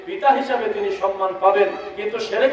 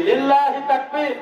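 rallying, drawn-out higher-pitched call; takbir call; 4 semitones higher, slower than usual. Real, not acted. Preacher leads the seated crowd in takbir, rallying call. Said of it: "আমরা একটু তাকবীর দেই".

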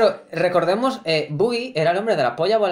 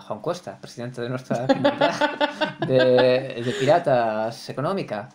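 much lower, softer conspiring voice; scheming Buggy; 7 semitones lower, 4 dB quieter than usual. Acted. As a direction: lower the voice like a crook with a deal. Voicing Buggy scheming with Luffy.

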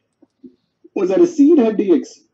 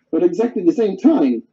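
usual speaking voice

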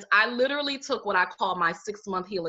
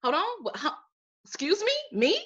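much higher, stunned mock disbelief; retold disbelief; 9 semitones higher than usual. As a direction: replay the shock of being fired. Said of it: "And I said".

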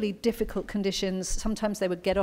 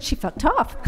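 higher, deadpan American punchline; American accent; 7 semitones higher than usual. Retold or imitated. [name] telling how the lion left, in a high American accent. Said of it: "excuse my American accent".

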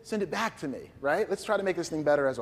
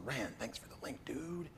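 very soft, half-whispered thanks; hushed aside; 3 semitones lower, 15 dB quieter, slower than usual. Real, not acted. Speaker thanking Rand for a link mid-demo, hushed aside. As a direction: drop almost to a whisper, low and private.

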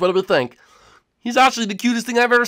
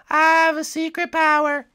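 Kirby voice; 7 semitones higher than usual. Acted. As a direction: say it like a tiny pink puffball. Kirby repeats.

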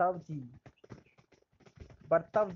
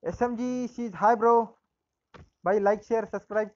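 excited shoutout; 4 semitones higher, 7 dB louder than usual. Real, not acted. Loud shoutout to viewer.

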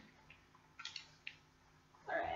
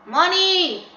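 excited shout; 8 semitones higher, 11 dB louder than usual. Real, not acted. Sees loot.

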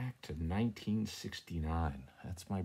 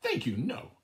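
sharp refusal; 8 semitones higher, 5 dB louder than usual. Acted. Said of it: "snapped she".

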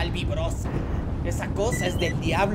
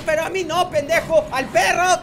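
high, loud mock-outraged protest; exasperated yell; 8 semitones higher, 8 dB louder than usual. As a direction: shout at a bungling helper. Helper shoots him by mistake. (grito agudo de reclamo)

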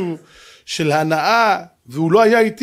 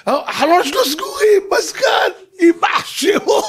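high-pitched, exclaiming astonishment; hammed-up squeal of amazement; mock amazed envy; 10 semitones higher, slower than usual. Acts out awe at air-conditioned car.

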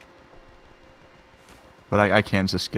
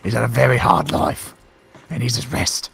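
mock Cockney bloke, a bit louder; defending Mister Bones in a cheeky British accent; hammed-up British accent; 3 semitones higher, 6 dB louder than usual. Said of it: "through your change of accent".

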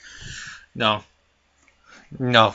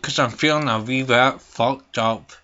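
ordinary talk

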